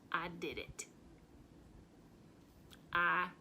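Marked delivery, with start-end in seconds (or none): 0.1-0.9 hushed whispered confession. Acted. Confessing the killing to the fan club in a whisper. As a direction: breathe the admission out.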